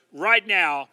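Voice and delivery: usual speaking voice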